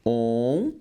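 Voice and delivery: "On" said alone as a nasal vowel; nasal syllable. Shown as a demonstration. Teacher isolating a sound for students, nasal. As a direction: hold the nasal "On" as a sound sample. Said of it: "o primeiro dígrafo vocálico está aqui".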